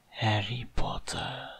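slow, hushed whisper of a villain. A narrator's reading. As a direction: whisper it low, drawing out the name. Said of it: "diye fısıldadı". (fısıltıyla, ağır ağır)

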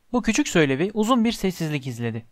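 ordinary delivery

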